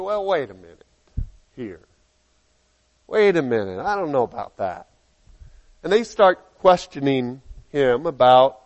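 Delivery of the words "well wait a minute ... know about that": skeptical, slowing down to question; doubtful neighbors. Acted. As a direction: hold back with suspicion. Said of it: "and they say".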